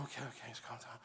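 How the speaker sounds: quiet okay, okay; hushed relief. Retold or imitated. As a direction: settle them down in a murmur. Calming down after birth.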